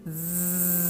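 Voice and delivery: slow, buzzing zzz with the voice on; voiced zzz. Shown as a demonstration. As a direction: hum the voice through a steady zzz. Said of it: "let's put our voice with it".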